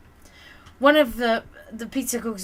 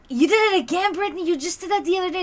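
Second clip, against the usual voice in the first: higher teasing accusation; teasing cooks; 8 semitones higher, faster than usual. Retold or imitated. Cooks ribbing Brittany about broken plates, teasing higher. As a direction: rib her like a cheeky cook. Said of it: "the cooks were like".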